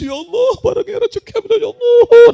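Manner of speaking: high-pitched wail rising to a loud cry; mock crying. Preacher imitates someone weeping to God, exaggerated high wail. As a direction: wail it as a hammed-up tearful plea. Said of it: "Bila tua nangis".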